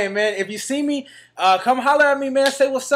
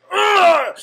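mock attack grunt; 10 semitones higher, 7 dB louder than usual. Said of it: "and I was like".

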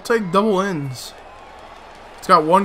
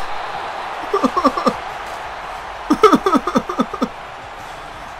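unvoiced, helpless laugh; breathy despairing laugh; 10 semitones higher, 5 dB quieter than usual. Real, not acted. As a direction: laugh at your own misery. Laughs after frustration.

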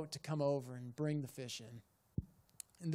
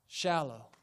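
ordinary talk

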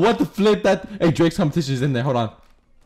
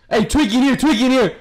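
high, raised-voice alert to teammates; urgent call-out; 7 semitones higher, 3 dB louder, faster than usual. Real, not acted. Calling out an enemy to teammates.

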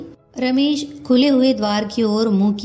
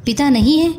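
higher, rising, astonished cry; startled question; 5 semitones higher, 4 dB louder than usual. A narrator's reading. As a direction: jump on the question in surprise. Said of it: "वह उछलकर बोली". (चौंककर ऊँचे स्वर में सवाल)

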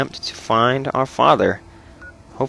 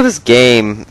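ordinary talk

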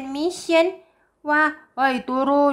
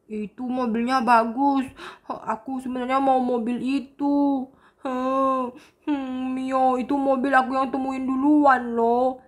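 put-on a sulky kid who lost his toy car; sad. Boy tells Mio he found the car first, mildly sad. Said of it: "Sebenarnya aku sedih".

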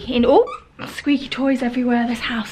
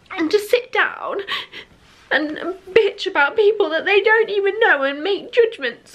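high, breaking voice through tears; crying; 7 semitones higher than usual. Real, not acted. Hurt vlogger speaking to the trolls, crying. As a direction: let it crack as you cry.

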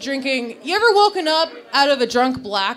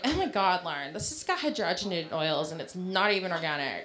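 mock health-nut friend; 7 semitones lower, 9 dB quieter than usual. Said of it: "they're like".

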